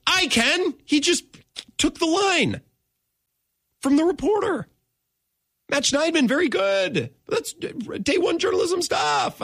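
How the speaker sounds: high, strained mock praise; odd put-on voice. Real, not acted. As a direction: go up high and sarcastic. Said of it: "I don't know why I'm talking like this".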